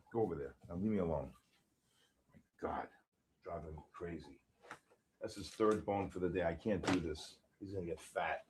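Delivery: far from the mic, scolding the dog; talking off-mic. Real, not acted. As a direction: grumble at the dog away from the microphone.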